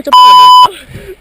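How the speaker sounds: loud, high reproach; loud exclamation. Real, not acted. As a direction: shout it at a teammate. Reproach after lost target.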